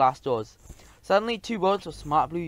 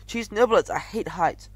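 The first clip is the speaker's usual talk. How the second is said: exclaiming; 6 semitones higher, 5 dB louder, slower than usual. Acted. Mouse hates heights, exclaims.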